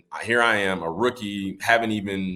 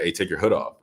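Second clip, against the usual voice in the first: usual speaking voice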